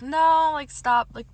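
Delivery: retold protest, high and loud on the no. Refusing the date's arm around her, loud protest. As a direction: act out pushing someone off with your voice.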